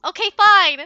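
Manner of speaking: loud high mock exasperation; playful loud huff; exasperated outburst. Real, not acted. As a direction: throw up your hands, loud.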